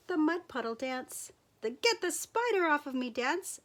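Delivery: ordinary delivery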